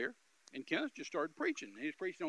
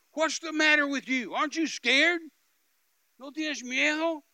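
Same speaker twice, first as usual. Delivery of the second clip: high, loud retold taunt; raised, forceful challenge; taunting shout; 10 semitones higher, 11 dB louder than usual. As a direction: act out a gunman goading his captive. Gunman taunting his captive.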